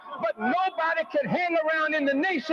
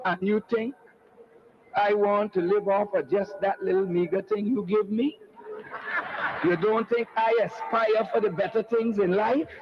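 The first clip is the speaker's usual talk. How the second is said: lightly imitated West Indian accent, lower and clipped; 7 semitones lower than usual. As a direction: voice his mother's West Indian accent as she answers the social worker. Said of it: "in her clipped West Indian accent".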